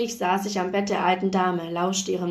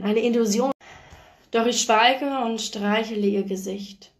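usual speaking voice

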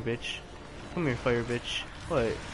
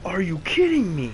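loud, higher disbelieving yell; exasperated outburst; 5 semitones higher, 8 dB louder than usual. Real, not acted. Gamer yelling at a game glitch, loud exasperation. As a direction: shout it in angry disbelief.

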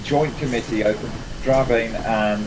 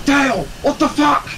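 mock-outraged, much higher; exasperated; 4 dB louder than usual. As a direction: squeak out disbelief at your friend. Exasperated at friend's gaffe.

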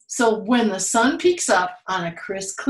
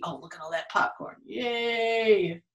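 ordinary delivery, nothing marked

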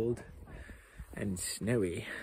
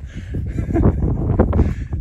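laugh; 9 dB louder than usual. Real, not acted. Laughing at reservoir.

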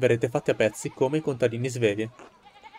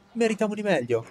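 excited villager; 9 semitones higher than usual. Acted. Villager cheering Martin.

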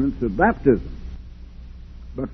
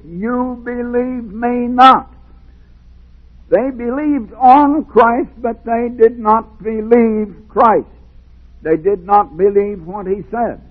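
higher, louder, slow preaching cry; full-force preaching; 7 semitones higher, 4 dB louder, slower than usual. Acted. Preacher pleads with the congregation, fervent preaching.

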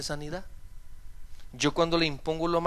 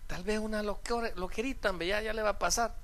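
higher, softer dismissive reassurance; 4 semitones higher, 5 dB quieter than usual. Retold or imitated. Pastor tells worried member it will pass, soft reassurance. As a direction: play it down gently, voice lifted and hushed.

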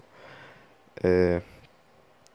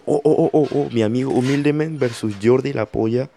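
excited; 4 semitones higher, 4 dB louder than usual. Real, not acted. Excited at the matchup reveal.

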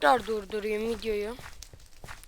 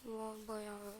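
very soft, hushed voice while hiding; 3 semitones lower, 13 dB quieter than usual. Real, not acted. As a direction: keep it low so nobody hears. Hiding from enemies in game.

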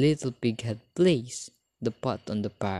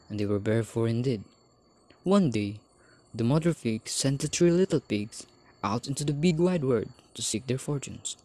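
usual speaking voice

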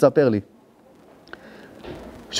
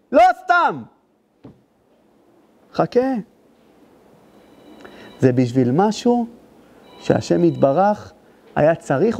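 קול דרשן גבוה ואיטי; להטיף בכל הכוח אל הקהל; דרשה בלהט; 12 semitones higher than usual. Acted. Preacher rises to full force. (דרשן המטיף בלהט לקהל)

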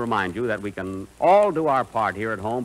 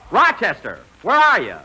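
calling out; 4 semitones higher, 6 dB louder than usual. Acted. Calling for Rochester in dark.

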